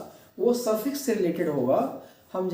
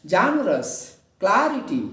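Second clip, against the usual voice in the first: "Generous" as JE-ne-rous, "clarity" as CLA-ri-ty; first-syllable stress. Shown as a demonstration. Teacher repeating the examples for students, first syllables stressed. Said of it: "stress देंगे".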